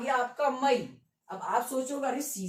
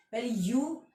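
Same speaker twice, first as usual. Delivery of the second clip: normal talking voice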